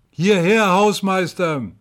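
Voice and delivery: loud, high, drawn-out call for the caretaker; high, slow yell up the stairwell; heartrending cry. A narrator's reading. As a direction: shout urgently for help. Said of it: "ein herzzerreißendes Geschrei".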